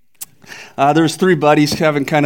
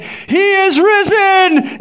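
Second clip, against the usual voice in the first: "He is risen." called out much higher and slowly, drawn out; raised voice; 3 dB louder, much slower than usual. Acted. Old priest's third call to the townspeople, loud and high. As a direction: proclaim "He is risen." at full voice, stretching every word. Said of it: "he raised his voice".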